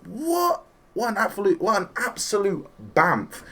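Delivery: a high squeak of disbelief that settles into awe; amazed disbelief. Retold or imitated. Fan reacting to Android Seventeen, high stunned disbelief. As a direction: gasp it out, stunned by how cool he is. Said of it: "And I'm like".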